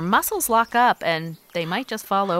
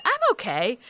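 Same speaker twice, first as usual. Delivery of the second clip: ordinary talk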